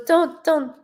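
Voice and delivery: imitating mom. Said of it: "She goes".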